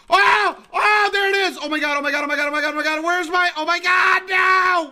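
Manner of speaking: high, loud shouts of panic and thrill; freaking out over a find; frantic excitement. Real, not acted. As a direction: shout in a rush of real excitement. Frantic at finding a legendary.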